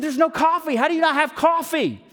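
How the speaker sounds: high, loud, entitled customer complaint; Karen complaint. Retold or imitated. Pastor retelling his coffee outburst to a barista, loud Karen whine. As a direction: play the indignant Karen demanding service. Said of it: "I did a Karen".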